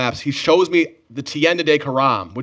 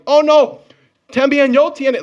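much higher, loud eager protest; eager exclaim; 11 semitones higher, 5 dB louder, slower than usual. Retold or imitated. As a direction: burst out keen to prove it. Retold eager reply, shouting back.

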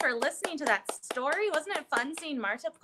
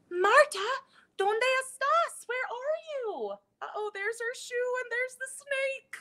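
much higher, calling out; worried calling; 11 semitones higher than usual. Acted. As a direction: call out searching for Marta. Calling for lost Marta.

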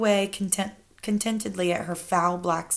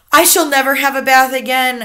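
put-on loud boy's firm declaration; boy announcing it with gusto; emphatic child; 5 semitones higher, 10 dB louder than usual. Boy vowing never to bathe.

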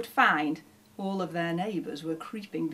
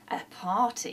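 usual speaking voice

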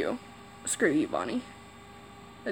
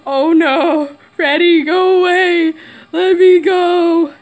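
louder, higher, slow frightened plea; 4 semitones higher, 6 dB louder, slower than usual. Real, not acted. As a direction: beg Freddy to leave. Freddy approaching.

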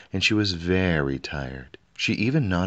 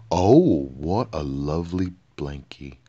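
delighted; 4 dB louder than usual. A narrator's reading. King delighted by cloth.

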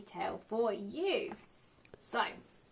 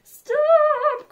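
shrieking cry; 12 dB louder than usual. A narrator's reading. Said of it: "cried the gingerbread man".

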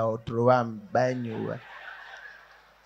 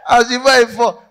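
words squeezed out through laughter; laugh-broken line; laughing while talking; 10 semitones higher, 11 dB louder than usual. Real, not acted. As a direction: still cracking up, loud and high. Still laughing.

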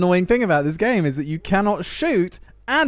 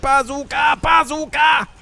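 high, repeated weapon call; yelling; 7 semitones higher, 3 dB louder than usual. Real, not acted. Player announcing two shots, yelling. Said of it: "the reason I'm yelling it".

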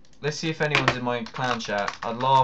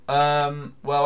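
usual speaking voice

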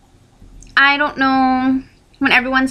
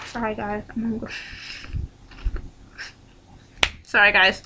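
chewing while talking; 4 semitones lower, 10 dB quieter than usual. Real, not acted. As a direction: mouth full, words mumbled. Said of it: "sorry, I'm chewing".

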